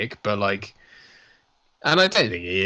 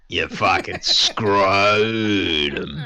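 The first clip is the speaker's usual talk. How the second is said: mock Australian insult; 3 semitones lower, much slower than usual. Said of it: "mock Aussie insult".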